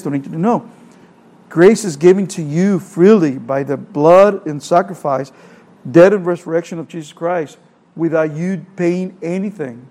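ordinary talk, nothing marked

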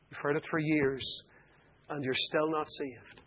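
quiet earnest appeal. Acted. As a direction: plead gently with the unsaved. Closing altar call to listeners.

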